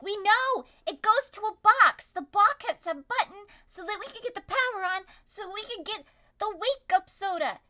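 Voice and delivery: yelling. Acted. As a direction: shout it back in frustration. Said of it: "they yell at us".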